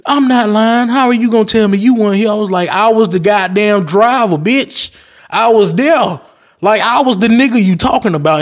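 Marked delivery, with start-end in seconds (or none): 0.1-2.3 much higher, fast mock customer insisting; indignant customer. Customer arguing on the phone. 2.7-8.4 heated retort. Retold or imitated. Shouting back at the customer.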